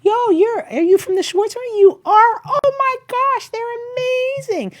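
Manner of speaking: very high, loud, gushing voice; gushing stranger. Retold or imitated. Imitating people who meet her, gushing high and loud. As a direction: mimic an overexcited fan of the family, high and loud.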